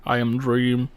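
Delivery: slow, mock-grave brooding voice; slow, solemn, self-serious voice; Dream impression. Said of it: "He talks like this too much".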